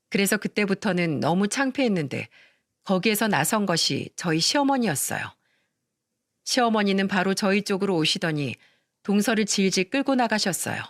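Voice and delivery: plain reading voice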